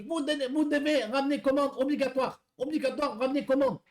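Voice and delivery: high, slowed broken French in a put-on foreign accent; mock foreign accent. Driver mimics the angry customer on the phone, mock foreign accent. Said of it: "je vous fais l'accent".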